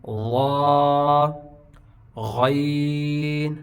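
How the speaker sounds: غا and غین voiced from the throat, held long; slow sustained throaty غین; throat-made ghain. Shown as a demonstration. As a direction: gargle the gh of غین and stretch the vowel. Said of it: "یہ بھی خلق سے ادا ہوتا ہے".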